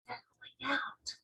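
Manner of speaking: soft unvoiced whisper, slow. Sophie watching bubbles leave, awed whisper. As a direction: breathe it in wonder. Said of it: "Sophie whispered".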